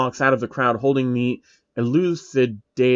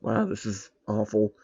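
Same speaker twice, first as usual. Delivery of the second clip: usual speaking voice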